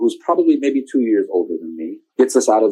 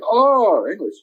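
high surprised oh on English; higher mock surprise of the soldier; 9 semitones higher, slower than usual. Soldier reacts, voiced.

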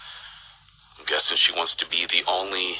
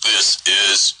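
slow, loud menacing broadcast; villain announcement; 4 semitones higher, 6 dB louder, slower than usual. Acted. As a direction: announce the takeover slowly, coldly. Villain takes over broadcast.

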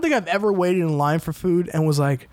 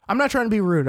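usual speaking voice

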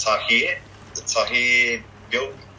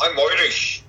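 exaggerated Irish accent, higher; bad Irish accent; 6 semitones higher, 4 dB louder, slower than usual. Shown as a demonstration. Second try at the accent for interviewer, bad Irish accent. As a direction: have a rough go at an Irish accent. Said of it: "it's, it's horrible".

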